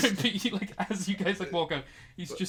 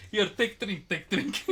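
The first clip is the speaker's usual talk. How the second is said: loud, raised character voice; urging companions; 4 semitones higher, 8 dB louder, slower than usual. Acted. Two mates tapping a freaking smoker's shoulder, loud urging. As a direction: press a drink on a friend, loudly. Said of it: "they're just like".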